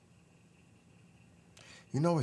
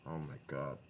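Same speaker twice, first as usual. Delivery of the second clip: normal talking voice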